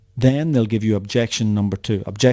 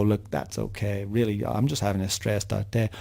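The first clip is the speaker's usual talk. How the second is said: softer, placating customer voice; accepting an apology; 2 semitones lower, 7 dB quieter than usual. Acted. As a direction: back down quietly, letting the caller off. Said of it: "they'll accept your apology and go".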